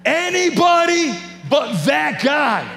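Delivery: loud, high, slow pleading shout at God; desperate shout of protest to God; shouted plea. Retold or imitated.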